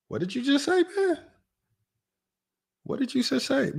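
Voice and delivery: disbelief. Real, not acted. Disbelief at the rap lyric.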